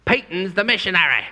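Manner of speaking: imitating a Scottish man badly; mock Scottish accent. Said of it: "I can't do a Scottish accent".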